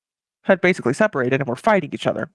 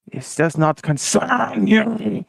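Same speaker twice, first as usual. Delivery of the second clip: slow, drawn-out mock threat; hammed-up, very slow insistence; emphatic warning; 6 semitones higher, much slower than usual. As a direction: stretch each word, pitch raised. North Korea repeats warning to America.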